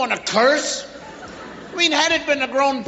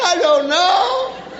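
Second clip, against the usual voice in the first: put-on slow, higher child's voice; clueless child; 4 semitones higher, slower than usual. Person caught doing wrong, asked why, clueless and drawn-out. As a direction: drag it out like a baffled kid. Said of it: "That's brain".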